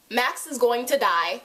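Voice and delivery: ordinary talk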